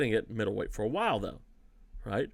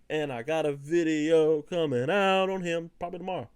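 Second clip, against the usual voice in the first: ordinary talk, nothing marked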